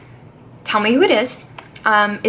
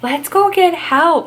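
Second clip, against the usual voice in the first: mock Nicole; 6 semitones higher, 5 dB louder, slower than usual. Imitating Nicole's urgent plea.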